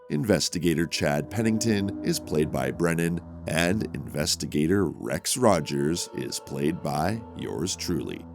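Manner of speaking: ordinary talk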